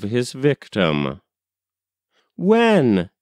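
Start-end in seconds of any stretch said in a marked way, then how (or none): 2.4-3.1 exclaiming. A narrator's reading. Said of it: "exclaimed the young man".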